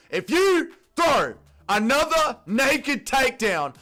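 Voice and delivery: high, loud, slow furious rant; angry shouting. Real, not acted. Fan threatening Stuart over another takedown, angry shouting. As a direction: yell each word out in exasperated rage. Yelling threats at fighter on screen.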